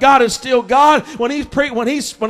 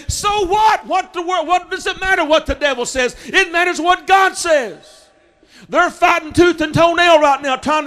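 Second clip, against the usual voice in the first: preaching shout; 4 semitones higher than usual. Acted. Preacher shouts defiance to the congregation. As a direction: cry it out with full force. Preacher climaxes against devil's threats.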